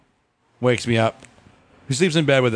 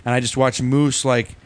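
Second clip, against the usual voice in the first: ordinary talk, nothing marked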